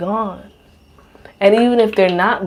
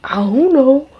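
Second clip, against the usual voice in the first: a lightly voiced partner, pitched up and offhand; imitating him; 6 semitones higher, slower than usual. Quoting partner shrugging about the strange bug, mock-high. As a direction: toss off a careless shrug of a line. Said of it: "And he's just like".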